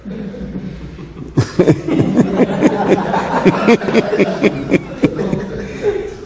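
real drawn-out fit of laughter; long helpless laugh. Real, not acted. Storyteller laughs at his wild train ride, long helpless laughter. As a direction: keep laughing, wordless, until it runs out.

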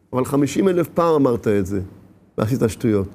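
low, offhand needling; dismissive reminder. Acted. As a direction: drop low and brush off the protest. Tempter reminds of past failures.

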